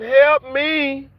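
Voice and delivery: mimicking people calling for help, hammed-up; mocking desperate plea. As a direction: whine it like flood victims begging. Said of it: "They hollering".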